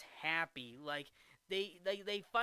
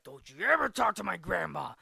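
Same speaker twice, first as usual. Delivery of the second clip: mock angry yell; 8 dB louder than usual. Happy angry at Shooter.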